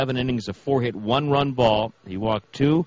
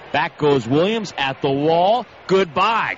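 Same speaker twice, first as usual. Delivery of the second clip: home run call; 5 semitones higher, 4 dB louder, slower than usual. Acted.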